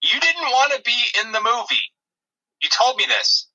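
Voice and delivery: usual speaking voice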